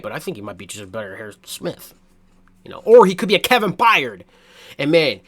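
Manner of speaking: ordinary delivery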